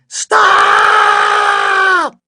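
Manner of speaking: piercing, high, long-held scream; child's all-out shriek; very loud yell. A narrator's reading. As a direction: scream it at full blast, holding the vowel. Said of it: "Taya yelled very loudly".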